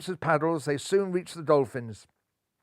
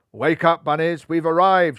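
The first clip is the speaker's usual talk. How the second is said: loud wake-up call; shout; 8 dB louder than usual. A narrator's reading. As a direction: rouse the sleeping team. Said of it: "shouted Captain Tom".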